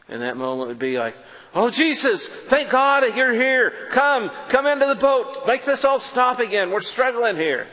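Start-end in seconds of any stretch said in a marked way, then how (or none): 1.5-7.7 relieved plea. Pretend. Imagined relieved plea to Jesus.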